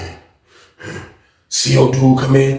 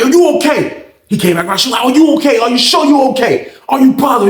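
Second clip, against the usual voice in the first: high, louder worried questions; concerned lady; 10 semitones higher, 4 dB louder than usual. Retold or imitated. Booth lady checking on the inmate, high worried questions. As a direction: ask him like a worried guard lady.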